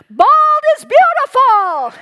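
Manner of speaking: ringing, high, loud proclamation; bold declaration. Retold or imitated. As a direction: declare it boldly to the room. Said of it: "declare as boldly and courageously as I could".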